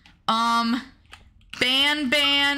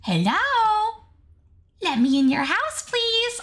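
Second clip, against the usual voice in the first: creepy begging voice; 11 semitones higher than usual. Acted. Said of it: "Yeah, I can, like".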